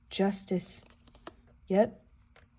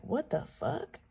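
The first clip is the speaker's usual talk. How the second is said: mock bafflement; 7 semitones higher, 5 dB quieter, slower than usual. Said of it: "And he's like".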